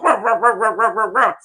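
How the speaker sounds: light yappy little-dog barks, half-spoken; mock chihuahua yapping. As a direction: bark like a tiny angry chihuahua. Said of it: "a Chihuahua go".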